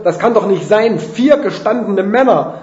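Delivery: high, loud, exasperated protest; Rufen. A narrator's reading. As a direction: call out in disbelief. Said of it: "Ich rufe". (laut und fassungslos gerufen)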